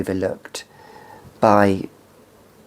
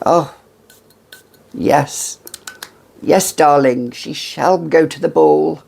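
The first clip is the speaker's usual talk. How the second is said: mock grand upper-crust accent, a little higher; posh aristocratic accent; 4 semitones higher than usual. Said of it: "an accent like that".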